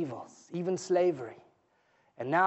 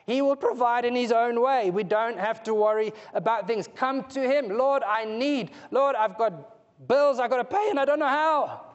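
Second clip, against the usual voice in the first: raised, louder preaching voice, pressing each plea; fervent preaching; 5 semitones higher, 3 dB louder than usual. Acted. Preacher urging the congregation to bring their needs to the Lord, fervent. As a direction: preach it out with urgency to the room.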